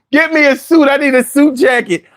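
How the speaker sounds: ordinary talk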